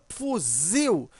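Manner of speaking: "fuzil" with a strong, drawn-out "zil"; stress on final syllable. Shown as a demonstration. Teacher showing the stressed syllable, "fuzil" stressed on "zil". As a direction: lean hard on the last syllable of "fuzil". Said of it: "ele é mais forte".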